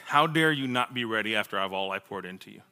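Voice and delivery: low, soft, solemn rebuke; God. Retold or imitated. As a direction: voice a grave divine reproach quietly, without shouting. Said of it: "hearing the voice of God".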